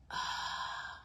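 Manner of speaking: long voiceless breath out; audible exhale. Real, not acted. Instructor breathing along with the shoulder exercise, audible exhale. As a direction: let the air out on an open "ah".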